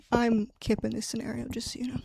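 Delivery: a quick hushed aside to the viewers; whispered aside. Real, not acted. Telling viewers she plays Kip, in a whispered aside. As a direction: breathe it out, unvoiced.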